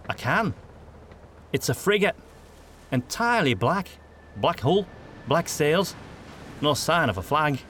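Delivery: a hint of a tense young lookout; Federhel voice. A narrator's reading. Federhel reports the ship.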